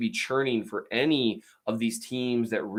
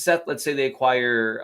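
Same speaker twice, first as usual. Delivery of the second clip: ordinary talk, nothing marked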